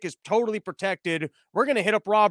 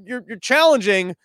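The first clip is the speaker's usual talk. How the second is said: ordinary delivery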